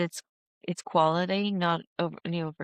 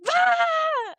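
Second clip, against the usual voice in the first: very high, loud scream of frustration; 4 dB louder than usual. Retold or imitated. Screaming at the laptop over bad live sound, full scream. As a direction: scream at the screen in despair. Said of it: "just screaming at the laptop going".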